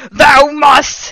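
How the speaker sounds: high, loud commanding yell; villain's yell. A narrator's reading. Voldemort insisting to the heroine, high theatrical yell. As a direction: thunder it like a stage villain. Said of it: "he yelled".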